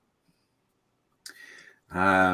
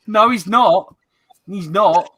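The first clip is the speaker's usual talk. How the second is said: emphatic refusal; 8 semitones higher, 6 dB louder, slower than usual. Real, not acted. Firmly refusing a donation idea.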